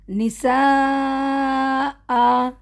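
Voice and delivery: نساء with a catch and a long آ for two counts; pausal recitation. Shown as a demonstration. Teacher showing the pause on نساء. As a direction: stop on نساء, hamza caught, آ stretched. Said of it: "اب میں یہاں رک رہی ہوں".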